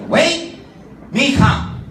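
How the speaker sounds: loud high broken-English chief; hurried chief's pidgin promise. Acted. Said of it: "Because it's Baroque English".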